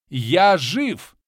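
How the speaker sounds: loud joyful cry; triumphant shout. A narrator's reading. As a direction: shout out being alive. Resurrected ally cries out.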